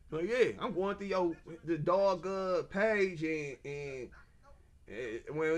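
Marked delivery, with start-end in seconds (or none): none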